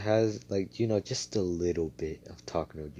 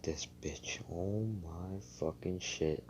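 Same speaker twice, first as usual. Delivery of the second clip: inner voice; 9 dB quieter, slower than usual. Retold or imitated. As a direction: act out cursing to yourself under your breath. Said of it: "Asta's just in his head".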